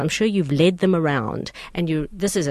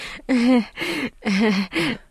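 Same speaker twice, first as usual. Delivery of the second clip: laugh; 5 semitones higher than usual. Real, not acted. Laughing about body laughter.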